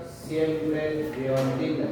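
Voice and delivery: "siempre llevan tilde" said very slowly; slow emphatic rule. Shown as a demonstration. Teacher to class, stating the rule slowly. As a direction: drag it out to drive it home. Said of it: "No hay regla".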